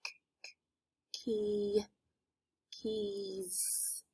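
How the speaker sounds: keys broken into k, k, key, softly; sounding out words. Shown as a demonstration. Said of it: "Let's sound out the word keys".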